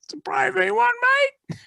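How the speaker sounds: squeaky high mock voice of the other golfer; hammed-up high voice of the golfer admiring the ball; mock fellow golfer. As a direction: pipe it up high, teasing the bloke who found the ball.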